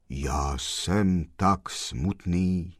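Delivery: low, slow, grave-like voice; sepulchral voice. Acted. The sad gentleman tells the postman his sorrow in a low, slow, sepulchral voice. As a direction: drag it out like from a tomb. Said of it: "povídal hrobovým hlasem ten pán".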